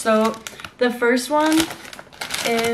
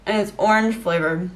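talking while chewing; speaking between bites; mouth full. Real, not acted. Seen 1: holds cake throughout; bites at 3.3, chews through 5.9.

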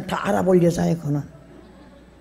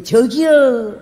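calling out; 3 semitones higher, much slower than usual. Retold or imitated. Calling husband.

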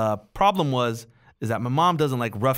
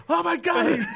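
high shouted alarm; hammed-up cry of a frantic father; mock panicked dad; 3 dB louder, slower than usual. Dad seeing son in the river, high panicked shout.

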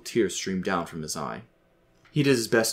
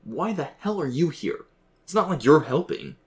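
high, exasperated challenge; angry; 8 semitones higher than usual. A narrator's reading. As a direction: demand it with raised pitch, fed up. Son angrily confronts his father's ghost.

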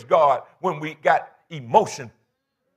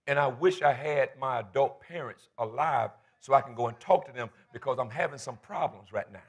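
low, soft and heavy; subdued, personal confession; confiding sorrow; 6 semitones lower, 8 dB quieter than usual. Real, not acted. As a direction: quietly admit the hurt. Confides missing parents and his problems.

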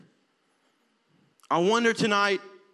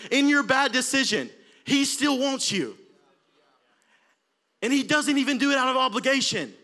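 high, loud, impassioned preaching; full-force preaching; 8 semitones higher, 4 dB louder than usual. Acted. Preacher urging the congregation, loud and impassioned.

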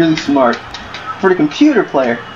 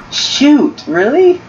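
surprised; 8 semitones higher, much slower than usual. Real, not acted. Missed shot in game.

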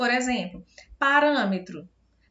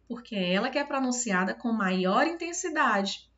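ordinary delivery

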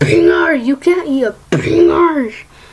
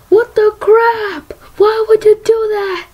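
mock troll; 9 semitones higher than usual. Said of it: "Troll was like".

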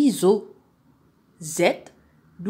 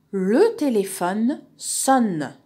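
slow, louder, deliberate story opening; slow model reading; 3 semitones higher, 4 dB louder, much slower than usual. Shown as a demonstration. Teacher opening a dialogue for pupils, slow model reading. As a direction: announce it slowly and clearly.

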